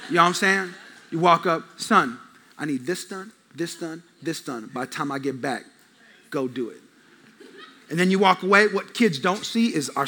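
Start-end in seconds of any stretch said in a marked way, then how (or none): 1.8-6.8 low, soft, terse dad orders; dad laying down the job very quietly; dad flow. Retold or imitated. Dad walks up to his son, low stern dad flow. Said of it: "That's called the dad flow".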